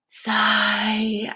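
breathy spoken sigh word; whispered sigh. Shown as a demonstration. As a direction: say sigh like a mock exhale. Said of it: "I'm very dramatic".

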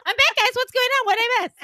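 hyper coked-up. Pretend. Back from the bathroom to friends, hyper coked-up. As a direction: bounce in way too wired. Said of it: "Could you imagine me on coke".

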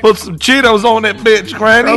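mock demand. Imitating the cousins' demand.

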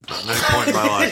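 laughing through the words. Real, not acted. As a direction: say it while cracking up. Repeats line laughing.

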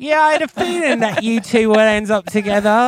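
high, loud, gushing [name] voice; [name] impression. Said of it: "[name] was like".

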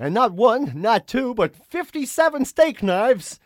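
loud, high hard-sell TV pitch; over-the-top infomercial parody; infomercial announcer. Acted. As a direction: stretch out each offer like a shopping-channel host. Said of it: "infomercials. Where it's like".

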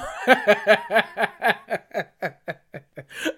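loud high laugh breaking out; burst of laughter. Real, not acted. Laughing at herself after nearly roasting mom.

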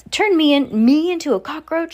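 high, loud, affronted shout. A narrator's reading. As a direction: bellow it in outraged disbelief. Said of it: "shouted Mister Jenkins".

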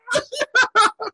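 high-pitched laughter; real laugh. Real, not acted. Laughing after his scream.

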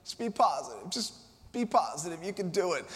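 soft mock pep talk to yourself; self pep talk. Speaker imitates a person lying in bed coaxing himself, softly.

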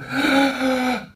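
high mock cry of horror; mock scream. Imitating bystanders screaming at the accident, mock shriek.

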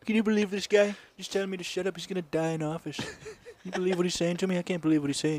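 lightly voiced whining politician; a hint of a complaining president; Trump impression.

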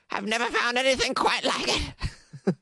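high, put-on elderly voice; Carrie, ancient woman. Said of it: "That's how she talks".

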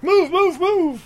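high, hammed-up shouting; mock yell. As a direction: yell it in a squeaky rush.

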